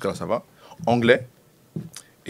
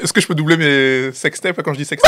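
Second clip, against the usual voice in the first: laughing shout; 5 semitones higher, 6 dB louder than usual. Real, not acted. Laughing plea to edit out slip.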